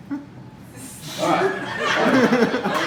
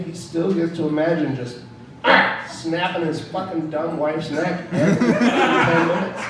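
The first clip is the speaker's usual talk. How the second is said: normal talking voice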